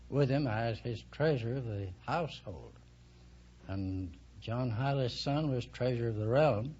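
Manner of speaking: ordinary talk, nothing marked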